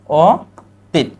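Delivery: অতীত drawn out slowly; slow pronunciation demo. Shown as a demonstration. Teacher answering with অতীত, slowly spelled out. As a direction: stretch অতীত for the class. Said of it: "এটা কি হবে?".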